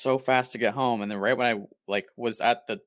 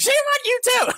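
very high, louder mock scold; old librarian scolding; 6 dB louder than usual. Librarian turning round to scold the mocking boy, very high mock scold. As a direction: snap back at him in a squeaky old-lady voice. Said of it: "she was like".

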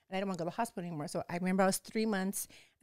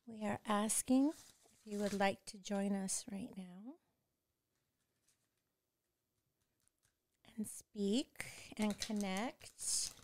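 slow, soft spiritual calling to the ancestors; solemn invocation; 5 dB quieter, slower than usual. Acted. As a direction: call the spirits in gently and slowly, like a prayer.

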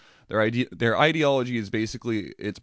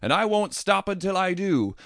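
much higher, slow, hammed-up neighborly shout; loud yell; 11 semitones higher than usual. Neighbor to you on the lawn, a mock loud yell. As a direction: bellow it across the street like a zealot. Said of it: "loudly yelled".

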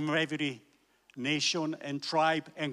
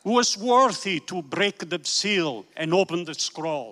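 raised, ringing preacher's reading; preaching scripture. Acted. Preacher reading Revelation to the congregation, raised and ringing. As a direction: proclaim the verses to the hall.

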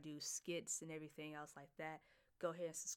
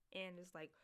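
ordinary delivery

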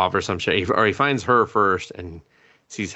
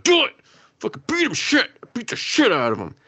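high-pitched, strained mock yell; egging him on. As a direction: cheer on the fight like a worked-up viewer. Acting out viewer cheering the beating.

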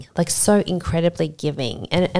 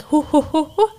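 high, rhythmic puffing hoos; imitating labour breathing; 9 semitones higher than usual. Surrogate breathing through the last push, imitated in high puffs. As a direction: puff out birthing breaths.

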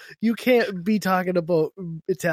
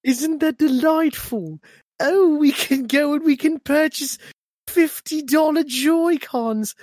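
put-on British accent; 9 semitones higher, 3 dB louder than usual. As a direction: gush like a posh Brit over overpriced gadgets. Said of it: "in your British accent".

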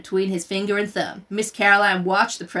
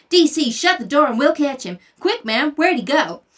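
put-on high, loud eager boy's voice; a lightly voiced eager southern boy; eager country boy; 5 semitones higher, 4 dB louder than usual. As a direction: urge the teacher to point out the mouse. Little Chuck continues quote.

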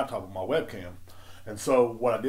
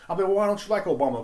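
higher, quick quoted question; imitated questioning; 8 semitones higher, 4 dB louder, faster than usual. Challenging the regulars about Obama, imitated high-pitched questioning. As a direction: ask it high and fast, replaying an argument. Acted argument with the store regulars.

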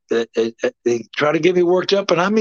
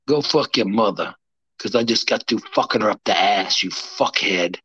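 low menace; 8 semitones lower, 3 dB quieter than usual. Real, not acted. Low menacing trash talk.

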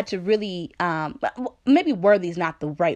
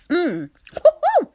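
embarrassed woo-hoo; 10 semitones higher, 6 dB louder, slower than usual. Real, not acted. Self-conscious reaction to own words.

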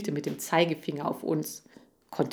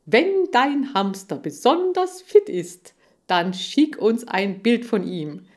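louder, showy pitchman; measured, emphatic advertising voice building to a cue; TV contest announcer; 3 semitones higher, 6 dB louder than usual. Acted. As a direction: announce the contest with salesman's gusto.

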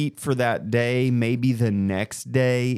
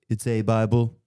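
light impression of the president's answer; Donald Trump. President answering a news reporter, light impression. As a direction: do a passing politician impression, level pitch. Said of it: "you doing the Trump impression".

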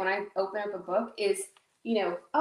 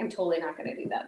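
ordinary talk

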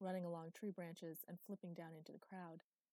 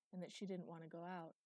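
ordinary delivery, nothing marked

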